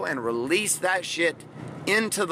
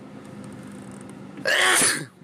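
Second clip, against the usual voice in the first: real sneeze. Real, not acted. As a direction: draw breath, then sneeze hard. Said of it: "I gotta sneeze".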